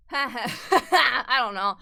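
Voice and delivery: loud burst of real laughter into the words; laughing. Real, not acted. Streamer unsure what to do, laughing it off. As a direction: laugh out loud while saying it.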